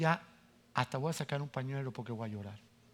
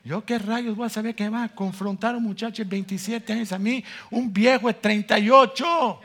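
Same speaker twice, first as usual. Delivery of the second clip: loud, higher, laughing disbelief; indignant outburst; 8 semitones higher, 10 dB louder than usual. Real, not acted. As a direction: blast it out, half laughing. Indignant outburst at being confronted.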